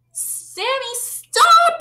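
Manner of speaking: very high, loud alarmed yell; children shout at Sammy to stop. Acted. Children yelling to stop him. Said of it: "yelled the children".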